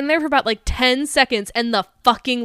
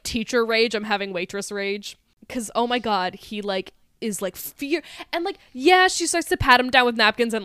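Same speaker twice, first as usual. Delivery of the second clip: normal talking voice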